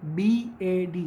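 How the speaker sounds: बी ए डी spelled very slowly letter by letter; slow spelling. Shown as a demonstration. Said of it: "तो बैड".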